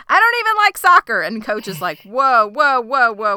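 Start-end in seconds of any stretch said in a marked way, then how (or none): 0.1-1.2 loud, high exasperated confession; acted outburst. Shauna finally confesses to Jackie mid-fight, acted outburst. 2.0-3.4 loud, higher string of interjections; mock alarm. Coach interrupts the girls' fight, mock alarm.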